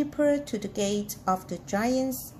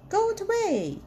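higher storybook yell, drawn to a falling end; 8 semitones higher than usual. A narrator's reading. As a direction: call it out high, as a crowd in a story. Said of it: "they yelled".

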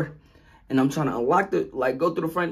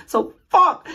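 frustrated outburst; 9 dB louder than usual. Real, not acted. Realizes key is missing.

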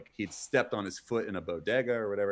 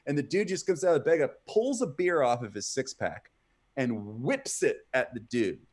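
ordinary talk

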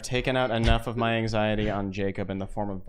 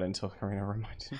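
whisper; 7 dB quieter than usual. Real, not acted. Confessing an aside.

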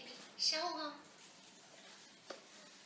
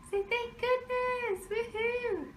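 put-on baby voice; 11 semitones higher, 5 dB quieter, slower than usual. Voicing for the baby.

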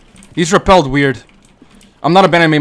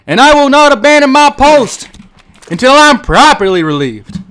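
mock soldier oath; 7 semitones higher, 4 dB louder than usual. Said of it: "doing that perimeter shit again".

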